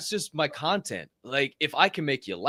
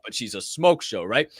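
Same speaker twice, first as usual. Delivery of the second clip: ordinary delivery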